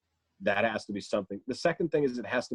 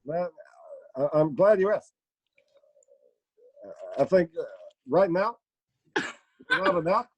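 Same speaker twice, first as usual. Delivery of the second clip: higher, louder, halting statesman with long pauses; deliberate stop-and-start presidential answer; Barack Obama; 5 semitones higher, 4 dB louder than usual. Acted. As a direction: stammer into each phrase, then pause. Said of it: "here, ask Obama a question".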